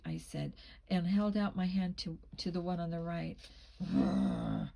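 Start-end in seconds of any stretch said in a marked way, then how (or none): 3.8-4.7 very low imitated growl; dog growl. A narrator's reading. Rottweiler warns off an outstretched hand, low growl. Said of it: "its growl getting louder".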